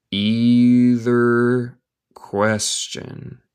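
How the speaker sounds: very slow, careful model reading; slow teaching read. Shown as a demonstration. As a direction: stretch it out for the learner.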